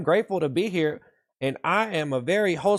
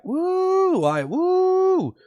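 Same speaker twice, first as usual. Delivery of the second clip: high excited cheer; 12 semitones higher, much slower than usual. Acted. Cheering viewer names.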